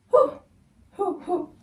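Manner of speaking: high, breathy puffs of relief; winded whew. Real, not acted. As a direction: blow out air as if you just climbed stairs. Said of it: "Why am I so out of breath?".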